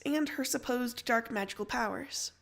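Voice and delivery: ordinary delivery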